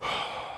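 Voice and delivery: soft, drained sigh; weary sigh. Real, not acted. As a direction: breathe out wearily. Worn down by the debt.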